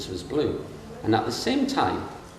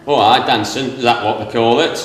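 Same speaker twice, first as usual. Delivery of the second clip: Yorkshire retort; 2 semitones higher, 10 dB louder than usual. Retold or imitated. Yorkshireman's wry retort retold.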